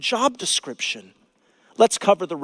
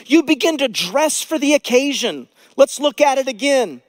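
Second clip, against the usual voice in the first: high, loud pulpit proclamation; impassioned sermon peak; full-force preaching; 8 semitones higher, 5 dB louder than usual. Acted. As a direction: drive it home to the congregation.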